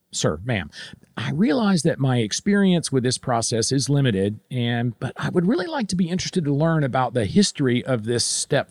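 measured, polite question to a superior; understated, deferential and careful; respectful. Acted. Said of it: "maybe say something like this".

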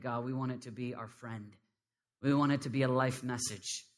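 low, subdued praying voice; heartfelt prayer. Real, not acted. Preacher praying over the congregation for intercession, low and prayerful. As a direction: speak earnestly to God, hushed and steady.

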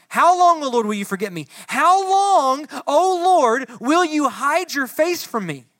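loud, high, pleading lament; anguished plea. Acted. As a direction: beg God to answer. Voicing David's lament loudly.

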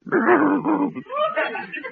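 high, hooting cartoon guffaw; a dopey high-pitched laugh; goofy hyuck laugh; silly goofy laugh. Laughs at own pun.

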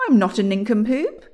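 loud, indignant protest; exclaiming. A narrator's reading. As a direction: protest loudly at the insult. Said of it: "exclaimed William".

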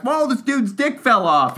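high, loud hammed-up alarm; mock shocked doctor. Joking about a doctor's hammed-up shock during an exam. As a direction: gasp it out like a startled medic. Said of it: "they're like".

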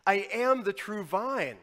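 loud, ringing declaration from the pulpit; a big preacher's cry; booming proclamation. Acted. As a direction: proclaim it to the back pew. Opening proclamation of Jesus' words.

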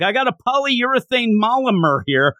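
mock yelling villain. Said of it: "starts yelling out things like".

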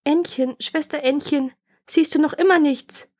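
high-pitched call up to the sister; wife's mildly anxious high call. A narrator's reading. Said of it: "Und dann rief sie".

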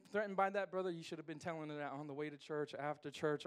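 ordinary delivery

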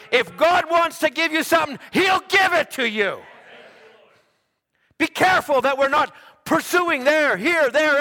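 loud, high, shouting sermon; preaching at full force. Acted. Pastor warning the church against chasing new things, shouted preaching. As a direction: thunder it at the congregation.

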